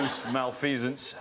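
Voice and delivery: normal talking voice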